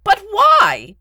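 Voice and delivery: high, loud, anguished question; bewildered outcry. Acted. Beetle demanding a reason from the Baron, bewildered outcry. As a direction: cry out in bewildered protest.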